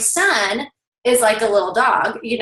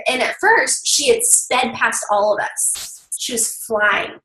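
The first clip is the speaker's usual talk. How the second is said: usual speaking voice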